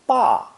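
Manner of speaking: "ba" starting high and loud, falling steeply; sharp falling tone. Shown as a demonstration. Teacher running through four tones, sharp falling "ba". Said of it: "spoken with a sharp falling tone".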